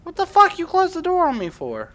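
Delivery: 1 startled outburst. Real, not acted.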